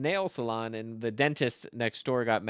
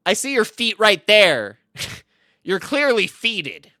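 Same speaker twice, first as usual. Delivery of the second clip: high, loud, slow retort; mock-triumphant high shout; shouted comeback; 9 semitones higher, 11 dB louder, slower than usual. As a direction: holler it as a punning zinger. Comeback to rival's boast.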